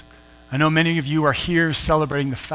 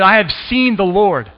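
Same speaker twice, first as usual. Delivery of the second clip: joyful announcement; 6 semitones higher, 7 dB louder, slower than usual. Acted. Mary tells the disciples.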